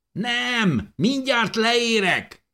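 high, stretched-out call; shouting back. A narrator's reading. Digger answers Dávid from the shaft, shouting back. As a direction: call back down over a distance. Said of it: "kiáltottam vissza".

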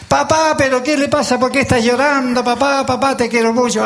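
put-on high-pitched, pleading child to father; worried daughter. Daughter finds her father crying, voiced high and pleading. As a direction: play a daughter anxiously calling her papa.

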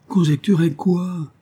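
high, slow pleading question. A narrator's reading. Evelyne pleads with the old nurse, high and slow. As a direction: beg the old woman to tell you. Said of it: "supplia Evelyne".

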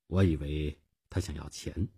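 ordinary delivery

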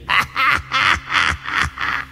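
high squealing cackle; shrill demon cackle. Acted. Demon giggle.

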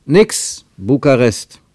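loud, urgent protest into the room; calling out. A narrator's reading. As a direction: call it out loudly. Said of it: "rief er".

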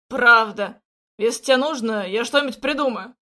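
loud, firm promise; emphatic. Acted. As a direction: punch the first word out. Jack insists.

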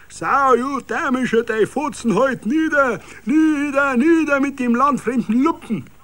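high, loud Bavarian abuse; angry roar. A narrator's reading. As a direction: bellow it in fury. Crowd roars abuse at Eisner.

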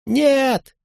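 very high, sustained cry; acted little boy crying out in protest; child's scream. A narrator's reading. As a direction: shriek it like a panicked child, without extra volume. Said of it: "кричал ребёнок".

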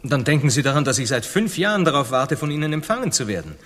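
laut und schnell empört; empörter Vorwurf. Acted. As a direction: dem König den Ärger ins Gesicht schleudern. Kolumbus beschwert sich empört. (Kolumbus wirft dem König die lange Wartezeit vor)

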